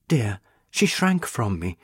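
plain reading voice

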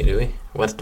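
normal talking voice

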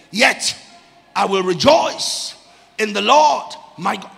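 loud, punched-out phrases with short breaks; forceful preaching. Real, not acted. Preacher showing the prophet's decision to rejoice, forceful preaching. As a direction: drive each phrase home to the congregation.

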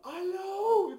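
very high, held-out mock shout; exaggerated yelled phone greeting; imitated phone bellow. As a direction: blast the hello like a foghorn. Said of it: "diye böyle bir bağırış geldi".

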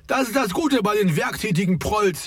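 lightly projected retort; calling back. A narrator's reading. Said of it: "rief Ferdi zurück".

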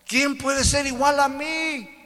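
high, slow divine challenge; God asking who can compare, high and slow. Acted. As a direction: ask it as God, slow and lofty.

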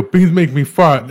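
high, slow and loud; childlike announcement. Retold or imitated. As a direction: spell it out proudly like a kid. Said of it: "And then he'd go".

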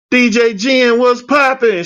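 high, upbeat hello; bouncy greeting. Retold or imitated. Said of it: "my normal self".

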